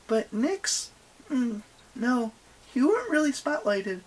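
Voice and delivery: high-pitched chatty tourist bluff; feigned-casual young heroine. Acted. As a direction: chatter high, as if unimpressed by the goddess.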